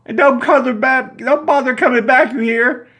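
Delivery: high, loud furious screech; screaming. Acted. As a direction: scream after the departing car. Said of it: "she continues to scream".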